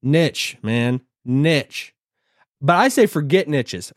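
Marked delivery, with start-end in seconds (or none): 0.0-0.6 short i and tch ending in "Niche"; nitch pronunciation. Shown as a demonstration. YouTuber trying out the word, "Niche" said as NITCH. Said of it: "everybody says it different". 1.3-1.9 "Niche" with short i, ending in tch; nitch pronunciation. Shown as a demonstration. YouTuber trying the word once more, "Niche" said as NITCH. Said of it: "everybody says it different".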